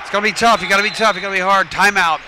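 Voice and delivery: loud coach-style urging; rallying shout. Real, not acted. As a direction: fire the team up from the booth. Fired-up shout at the players.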